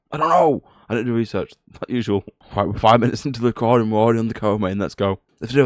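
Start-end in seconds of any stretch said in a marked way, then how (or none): 0.1-0.6 exasperated high I don't know. Real, not acted. Repeat said higher.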